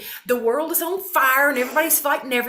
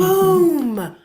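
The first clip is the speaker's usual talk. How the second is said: higher, louder punchline; emphatic boom; 7 semitones higher, 8 dB louder than usual. Real, not acted. Driving home her point to listeners, an emphatic boom. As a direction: land it like a mic drop.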